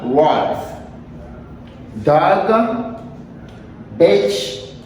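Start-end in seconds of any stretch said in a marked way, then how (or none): 0.0-0.6 Wolf with the l sounded before f; pronounced L. Shown as a demonstration. Teacher tells students the L is said, Wolf with sounded l. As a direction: let the l be heard in Wolf. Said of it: "Elle l'a prononcé là".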